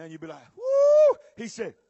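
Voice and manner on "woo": high, loud celebratory whoop; relieved whoop. Acted. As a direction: let out a big whoop. Acts believer's relief.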